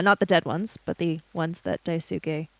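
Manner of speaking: usual speaking voice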